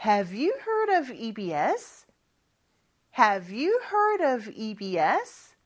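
very slow rising question, repeated; slow drill. Shown as a demonstration. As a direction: lead the class through it slowly. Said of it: "같이 한번 연습해볼까요?". (학생들과 천천히 따라 하는 연습)

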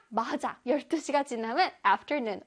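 usual speaking voice

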